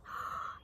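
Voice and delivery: sharp deep breath before the jump; big gasp in. Acted. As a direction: suck in a mighty breath. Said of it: "He took a mighty breath".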